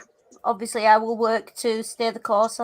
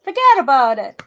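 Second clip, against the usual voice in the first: louder mock New York dismissal; New York accent; 5 dB louder than usual. Friend joining the accent joke, hammed-up New York accent. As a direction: toss it off like a cliché New Yorker. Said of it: "New York accent".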